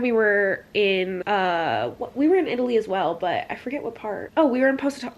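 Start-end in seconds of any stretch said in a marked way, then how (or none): none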